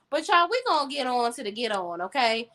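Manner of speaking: ordinary delivery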